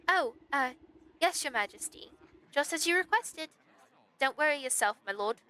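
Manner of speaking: flustered, high-pitched yes-man; nervous noble underling. Acted. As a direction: reassure your king a little too hastily. Nobles nervously answer the stag.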